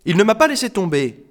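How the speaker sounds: high, loud, offended cry; outraged high-pitched retort; indignant protest. Acted. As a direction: deny it with outrage. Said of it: "se récria-t-elle, indignée".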